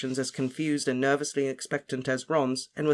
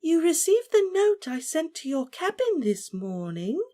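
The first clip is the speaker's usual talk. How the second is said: much higher, prim condescending lady; loud slow voice. A narrator's reading. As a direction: speak to him as if he were slow. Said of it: "in the same loud, slow voice".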